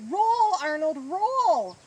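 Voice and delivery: big, high, drawn-out cheering holler; yell. A narrator's reading. Boyd cheers Arnold on from the game, yelling. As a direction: yell it out to urge the runner on. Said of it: "Boyd yelled".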